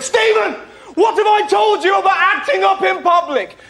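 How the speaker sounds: high, scolding mock-dad shout; long-suffering dad. Dad confronting his misbehaving son, high angry scolding. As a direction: shout the name, then scold at high pitch. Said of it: "His long-suffering dad".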